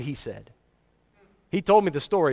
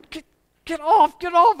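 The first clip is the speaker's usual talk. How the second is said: high, loud mock-exasperated get off; half-laughing brush-off of a child; shooing a clingy kid; 12 semitones higher, 5 dB louder than usual. Missionary trying to shake a kid off his leg, loud and high.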